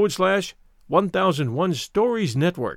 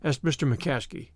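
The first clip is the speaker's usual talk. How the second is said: plain reading voice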